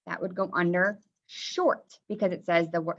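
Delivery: ordinary delivery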